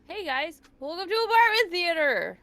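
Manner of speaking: high, loud welcome; excited show greeting. Real, not acted. Host greeting viewers as stream goes live, excited shout. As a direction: call out a big hello to the audience.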